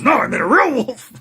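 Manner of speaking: high, loud mock-pleading; hammed-up, near-wailing insistence; exasperated werewolf. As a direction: protest in comic desperation. Retold desperate werewolf's protest.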